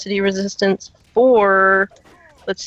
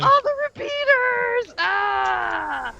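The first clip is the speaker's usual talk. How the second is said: high drawn-out wail; exaggerated high-pitched freak-out; mock meltdown; 14 semitones higher, much slower than usual. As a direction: cry out in comic despair, stretching it. Mock outburst about repeaters.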